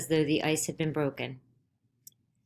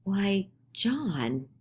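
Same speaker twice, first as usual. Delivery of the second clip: astonished; 4 semitones higher than usual. A narrator's reading. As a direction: let the shock catch in the throat.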